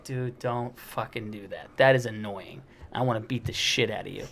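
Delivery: low, understated irritation; annoyed warning. Acted. To a friend who mushed his face, low annoyed warning. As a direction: warn him off quietly, holding the annoyance down.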